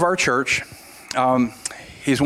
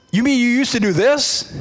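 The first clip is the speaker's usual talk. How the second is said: a man interrupting the sermon in disbelief; shocked blurt; 8 semitones higher than usual. Retold or imitated. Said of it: "he says".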